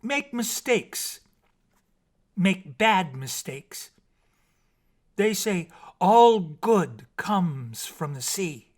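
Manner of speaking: slow, high and loud with triumph; a mermaid's slow triumphant declaration. A narrator's reading. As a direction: announce it like a proud discovery. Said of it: "then said triumphantly".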